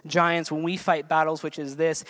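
ordinary talk, nothing marked

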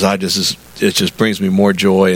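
lightly voiced low Southern man; deep Southern voice. Farmer in his mid-eighties loving the work, deep Southern voice. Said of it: "[name] speaks with a deep Southern voice".

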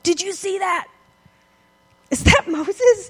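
high, stunned whisper-like gasp of wonder; amazed disciple. Retold or imitated. Disciple marvelling to his friend, high and slow. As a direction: gape at it like a startled disciple.

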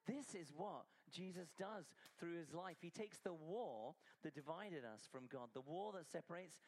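emphatic preaching. Real, not acted. Preaching climax after demo.

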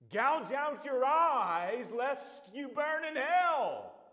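loud, slow Texas drawl in the Piper quote; Texas accent. Retold or imitated. Piper answering the panel question, loud slow Texas accent. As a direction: boom it out slowly in a thick Texas accent. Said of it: "in his thick Texas accent".

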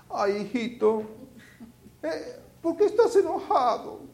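very high, far-off cooing doting mom voice; doting mother. Retold or imitated. Preacher mimics a doting mother soothing her angry son, high cooing. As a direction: coo like a mother spoiling her little boy. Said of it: "aquellas madres que miman mucho".

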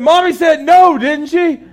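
high, loud scolding question; scolding dad. Retold or imitated. Dad scolding toddler for ignoring mommy, high and loud. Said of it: "I said".

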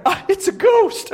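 high, slow cry of pretend fright; hammed-up scare, pitch leaping up; mock terrified. Women mistaking the man in the tomb for a ghost, mock terror. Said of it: "They were absolutely terrified".

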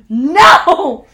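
laughing yell refusing the climb; laughing shout. Retold or imitated. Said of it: "And I'm like".

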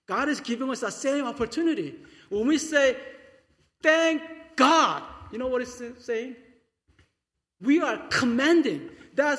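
loud, high, impassioned sermon climax; full-force preaching. Acted. Pastor urging the congregation to command by thanks, full-force preaching.

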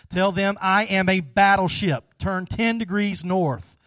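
acted irate captain laying down the law. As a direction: pull rank with mock anger. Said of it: "He was irate".

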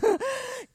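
high-pitched amused laugh. Real, not acted. Preacher laughs over not reaching him by phone, amused laugh. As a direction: laugh at yourself mid-story. Laughs at her own story.

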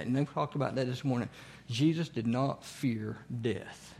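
usual speaking voice

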